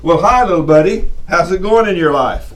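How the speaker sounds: put-on loud, forceful greeting to a child; strict grown-up voice. Shown as a demonstration. Man talking to a little child untoned-down, loud and forceful. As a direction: boom it out without toning it down. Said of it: "tone it down a little bit".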